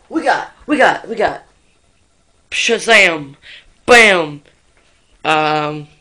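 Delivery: loud chaotic yelling; shouting. Acted. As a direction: yell it out frantically.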